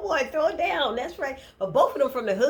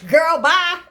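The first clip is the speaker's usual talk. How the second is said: put-on loud, sassy "Girl, bye"; sassy dismissal; 4 semitones higher, 10 dB louder than usual. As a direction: dismiss her with attitude. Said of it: "I got on my Madea shirt".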